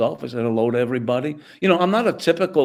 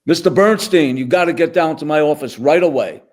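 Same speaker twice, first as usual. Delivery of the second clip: higher and louder, booming over the intercom; loud intercom call; 5 semitones higher, 7 dB louder than usual. Retold or imitated. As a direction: call him down sharply over the loudspeaker. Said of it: "'cause it was very loud".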